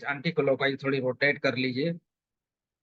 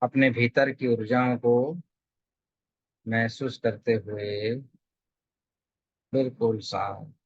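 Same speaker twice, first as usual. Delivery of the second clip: calm, measured meditation guide; settled, evenly paced guidance with long pauses; guided meditation; 3 semitones lower than usual. Real, not acted. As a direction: lead them gently into stillness, phrase by phrase.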